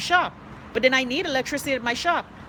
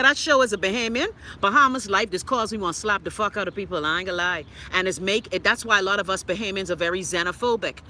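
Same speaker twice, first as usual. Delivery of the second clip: ordinary delivery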